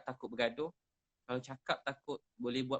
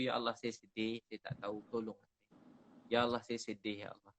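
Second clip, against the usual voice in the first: mournful prayer; 3 semitones lower, 6 dB louder than usual. Retold or imitated. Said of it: "Dia ulang-ulang cakap tu".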